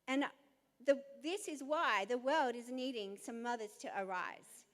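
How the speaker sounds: usual speaking voice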